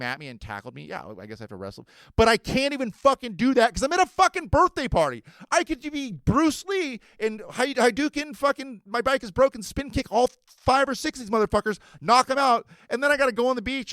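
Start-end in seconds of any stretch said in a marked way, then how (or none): 2.2-5.2 frustrated outburst. Real, not acted. Frustrated rant at party. 5.5-13.9 loud and high, hyped-up fantasy; fight fantasy. Pretend.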